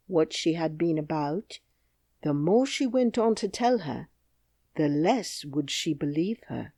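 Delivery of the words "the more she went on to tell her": ordinary delivery, nothing marked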